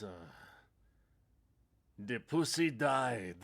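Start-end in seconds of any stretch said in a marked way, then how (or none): 2.0-3.4 put-on slow Quebec-accented drawl of a favorite movie line; imitating an actor's slow Quebec French accent; Michael Parks impression. Fan quotes a favorite Kill Bill line, slow Quebec accent. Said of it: "is when he goes".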